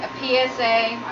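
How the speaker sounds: ordinary delivery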